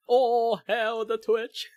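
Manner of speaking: high, loud, slow mock shock; exaggerated gasp of surprise. Mock reaction to Twitch.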